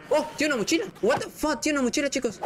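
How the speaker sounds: very high-pitched amazed exclamation; astonished. Real, not acted. Player spots a backpack and tells viewers, astonished. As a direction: blurt it out high, in disbelief.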